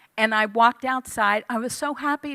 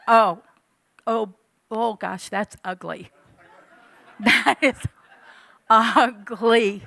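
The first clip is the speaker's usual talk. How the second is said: mirror shock; 5 dB louder, slower than usual. Retold or imitated. Sees herself in mirror.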